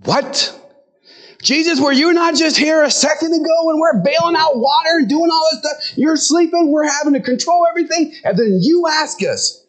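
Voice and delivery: high-pitched, worked-up protest; indignant disbelief. Acted. Disciples to Jesus after bailing water, indignant. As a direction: argue back in an incredulous high voice. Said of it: "They panicked and they became angry".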